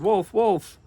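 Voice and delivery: high, loud cry of wolf; hollered. Acted. Shepherd girl hollering for the farmers, loud high cry. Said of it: "She hollered".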